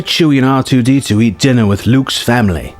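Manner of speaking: usual speaking voice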